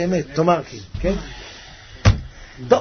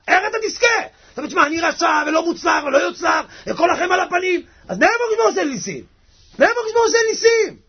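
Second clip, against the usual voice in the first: raised, agitated self-reproach; fervent preaching; 11 semitones higher, faster than usual. Acted. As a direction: cry it out in impassioned despair. Preaches voicing the inner accuser.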